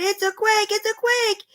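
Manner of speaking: high panicked cry; panicked shout. A narrator's reading. Animals panicking as the earth rumbles, high cries. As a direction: cry out that it's a quake. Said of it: "they shouted".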